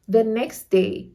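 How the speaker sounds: normal talking voice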